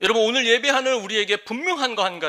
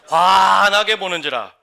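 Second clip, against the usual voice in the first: proclaimed loudly; 6 dB louder, slower than usual. Shown as a demonstration. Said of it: "저를 따라하세요".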